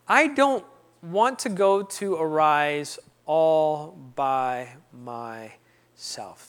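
word by word, dragging and dropping at the end; slow, deliberate refusal. Retold or imitated. Man telling God his next condition, slow and deliberate. Retold demand, drawn out word by word.

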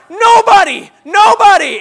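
high, loud panicked yell; panicked shout. Retold or imitated. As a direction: scream a warning as the truck comes. Truck coming at the dog.

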